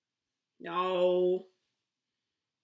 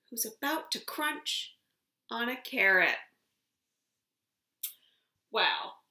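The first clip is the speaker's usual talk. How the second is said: ordinary talk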